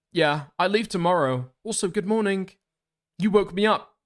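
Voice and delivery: high-pitched texting character; message writer's voice. Acted. Streamer reading out a morning text exchange, high put-on voice.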